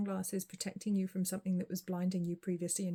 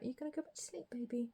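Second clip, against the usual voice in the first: pet talk; 4 semitones higher, 7 dB quieter, faster than usual. Real, not acted. Talking to her sleepy cat.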